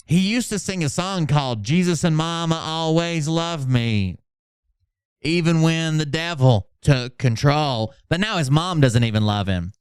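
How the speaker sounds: usual speaking voice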